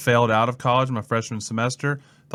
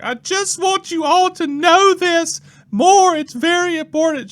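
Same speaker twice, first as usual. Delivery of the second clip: very high, loud hammed-up wail; mock crying; 8 dB louder than usual. Church lady pleading with the class, hammed-up crying. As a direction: imitate a hysterical tearful plea. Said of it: "then she starts crying".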